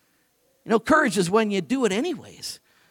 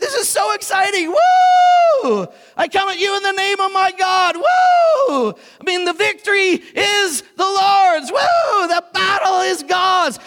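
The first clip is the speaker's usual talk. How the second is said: much higher, loud whooping shouts; ecstatic battle cry; 9 semitones higher, 5 dB louder, slower than usual. Acted. David running at Goliath, whooping and shouting with joy. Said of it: "He said".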